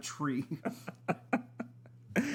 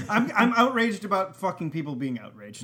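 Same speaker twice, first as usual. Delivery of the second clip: louder, laughing mock outrage; half-laughing rant, louder; getting mad; 4 semitones higher, 6 dB louder than usual. Real, not acted. Ranting about outraged people, louder and half laughing. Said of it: "I'm getting mad".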